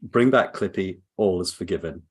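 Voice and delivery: usual speaking voice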